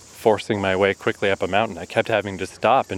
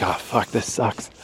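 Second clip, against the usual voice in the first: muttered complaint; 4 dB quieter than usual. Retold or imitated. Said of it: "I was like".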